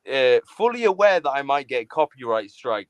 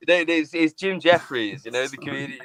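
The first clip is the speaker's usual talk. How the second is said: usual speaking voice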